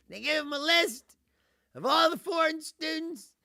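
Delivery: usual speaking voice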